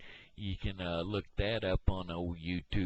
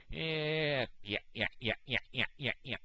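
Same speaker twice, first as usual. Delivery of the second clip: character answering yeah; 9 semitones higher than usual. Retold or imitated. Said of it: "who those characters are".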